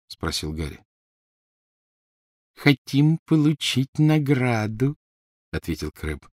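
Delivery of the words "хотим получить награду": a big lug speaking surprisingly gently; gentle voice. Acted. Crabbe explains to Harry, in a slow, higher gentle voice. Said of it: "удивительно нежный голос".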